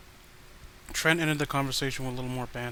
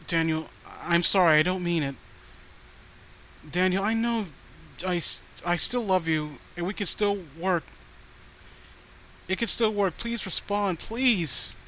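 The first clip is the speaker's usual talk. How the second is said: higher, pleading apology that climbs; desperate pleading; 3 semitones higher, 3 dB louder than usual. Acted. Stalker girlfriend begging Daniel to respond, desperate plea. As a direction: beg him to answer, voice rising. Crazed ex begging after outburst.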